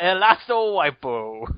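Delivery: slow, drawn-out mock Spanish; mock Spanish name. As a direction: stretch the name out like a wrestling announcer's Spanish. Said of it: "the Mexican assassin is what we call him".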